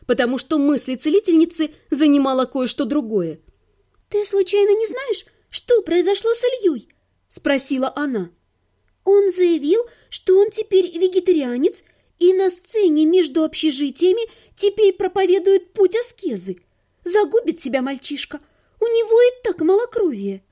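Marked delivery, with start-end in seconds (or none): 4.1-6.9 higher, worried female voice; a higher, concerned healer's voice; worried young woman. Acted. Lira voiced in story. 9.0-20.4 higher, worried female voice; a higher, concerned healer's voice; worried young woman. Acted. Lira voiced in story.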